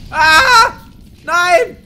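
a very high, loud shriek of no; startled scream. Real, not acted. Player caught by surprise, screaming in fright. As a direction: scream as the danger hits. Something attacks in game.

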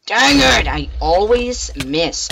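loud, high frustrated outburst; frustrated yell. Real, not acted. Gamer keeps missing throws, yelling in frustration. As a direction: yell at your own bad aim.

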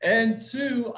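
ordinary talk

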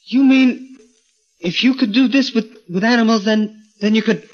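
high, rushing, stumbling with hope; eager excitement. Acted. Gorgo imagines growing, high and eager.

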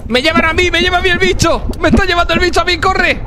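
grito agudo de pánico al ser arrastrado; gritando con pánico. Acted. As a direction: pide ayuda mientras se lo llevan. Atrapado por el bicho. (grito de pánico)

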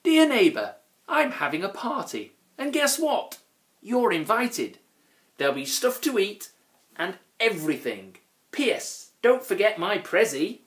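high-pitched, chirpy note-writer's voice; party invitation voice. A narrator's reading. Neighbour inviting the Grunt to a party, read in a high voice. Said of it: "he read the scruffy writing".

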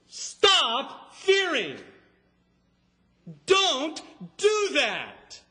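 loud, high, very slow rebuke; emphatic command. Acted. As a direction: forbid it forcefully, drawing out each word. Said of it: "is emphatic. It's the most emphatic negation".

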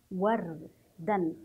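وردا drawn out, ر held without a vowel; sukun on the ra. Shown as a demonstration. Teacher repeats the reading of ra with sukun, slow syllable demo. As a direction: say وردا as "warr", a gap, then "dan". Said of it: "انظروا كيف سنقرأ حرف الراء".

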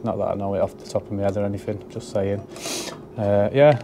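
ordinary talk

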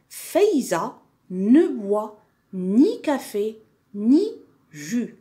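slow, deliberately spaced reading; slow dictation. Shown as a demonstration. Teacher dictating a sentence to pupils, slow dictation. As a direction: dictate slowly, phrase by phrase.

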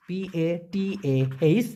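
slow letter-by-letter spelling of পাতাহ. Shown as a demonstration. Teacher spelling পাতাহ for the class, slowly. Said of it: "পাতাহ".

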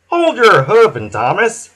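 a big friendly grin in the voice; smiling Hank. A narrator's reading. Hank teasing Thomas, jolly and loud. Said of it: "smiled Hank".

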